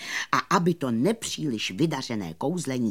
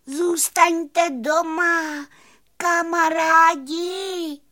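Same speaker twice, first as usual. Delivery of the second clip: high, loud, slow long-distance call; calling after them; 5 dB louder, much slower than usual. A narrator's reading. Fairy begging departing friends to stay, high drawn-out shout. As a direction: cry out, stretching every vowel. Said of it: "křikla za nimi".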